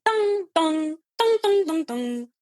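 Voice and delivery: high, loud tolling dongs; imitated piano notes. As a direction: mimic piano keys struck over and over. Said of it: "it's just continuing".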